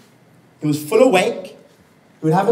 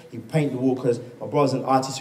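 normal talking voice

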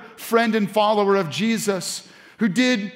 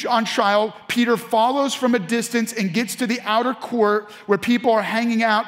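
ordinary delivery

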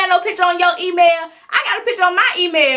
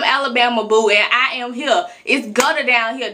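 ordinary delivery

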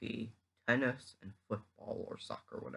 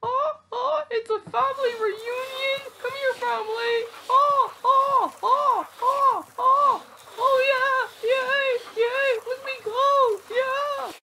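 squeaky high voice; 7 dB louder than usual. Acted. Excited family reunion character.

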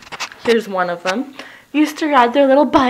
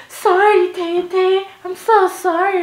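apologetic cousins; 8 semitones higher, 4 dB louder than usual. Retold or imitated. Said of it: "they'd come to my window and be like".

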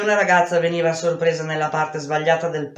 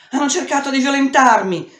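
loud, much higher desperate cry; screamed; 10 semitones higher, 4 dB louder than usual. Acted. The girl tells the neighbour what happened, screaming. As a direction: cry it out to the kind lady.

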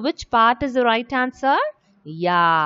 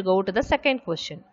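usual speaking voice

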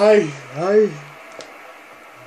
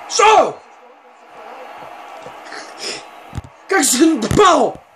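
excited outburst; 6 semitones higher, slower than usual. Real, not acted. Gamer sees the ball hit the post, excited shout. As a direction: shout it, stretching the last word.

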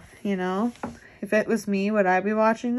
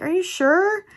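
high, louder mock disbelief; doubtful quote; 10 semitones higher, 5 dB louder than usual. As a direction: act out asking in surprise. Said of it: "And I was like".

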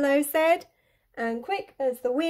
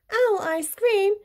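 higher, startled question; alarmed echo; 10 semitones higher than usual. A narrator's reading. Owl learns he is the favourite food, alarmed. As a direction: repeat it in shocked disbelief.

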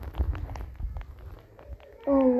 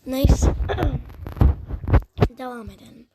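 usual speaking voice

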